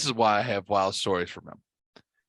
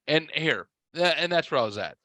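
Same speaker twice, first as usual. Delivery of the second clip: ordinary talk, nothing marked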